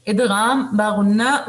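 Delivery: normal talking voice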